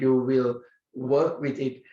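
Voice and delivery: normal talking voice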